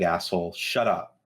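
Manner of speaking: ordinary talk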